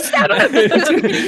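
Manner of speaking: loud, gleeful attack yell; playful high-energy shout; mock battle cry. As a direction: yell it over the laughter. Table playfully urging an attack.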